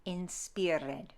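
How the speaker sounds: "Inspired" as in-SPEER-red, slight trill; lightly rolled R. Shown as a demonstration. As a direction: roll the R weakly in "Inspired", sounding -ed. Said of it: "give it a little roll".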